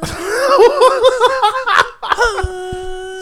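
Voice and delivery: helpless high cackle; real laughing fit; high wheezing laugh. Real, not acted. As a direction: crack up high and hard. Breaks into laughter.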